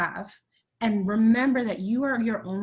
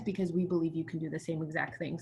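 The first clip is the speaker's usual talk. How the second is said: ordinary delivery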